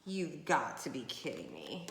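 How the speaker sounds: ordinary talk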